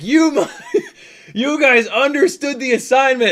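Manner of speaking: high, loud, laughing shout; reenacted exultant yell to a crowd; hyped-up praise.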